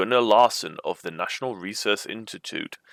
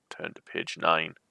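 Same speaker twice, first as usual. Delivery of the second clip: ordinary delivery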